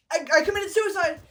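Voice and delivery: loud, high, fast exclamation; mock frantic outburst. Desdemona covering for Othello, mock frantic cry. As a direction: blurt it out, hammed up. Acts Desdemona changing her claim.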